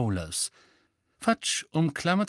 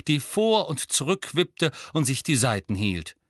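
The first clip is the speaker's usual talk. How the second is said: ordinary delivery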